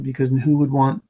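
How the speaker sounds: ordinary delivery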